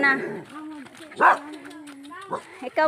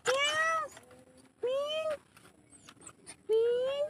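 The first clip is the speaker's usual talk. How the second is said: much higher, soft little meows; high cat meow; 9 semitones higher, 9 dB quieter, much slower than usual. Pretend. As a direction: mew like a kitten. Voicing a cat.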